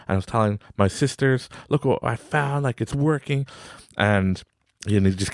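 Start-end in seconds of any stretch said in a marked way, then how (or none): 1.7-3.5 higher, eager kid imitation; excited child. Son shows grandma found change, higher excited imitation. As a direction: show it off in a raised kid voice.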